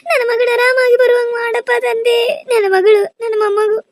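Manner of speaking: tearful prayer. Acted. Mother begging god for her daughter, weeping aloud. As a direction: sob out the prayer to Shiva.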